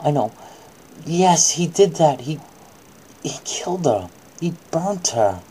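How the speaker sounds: usual speaking voice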